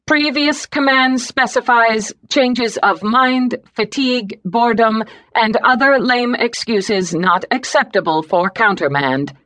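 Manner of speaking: a machine reading its rules; computer voice. Acted. Computer system voice.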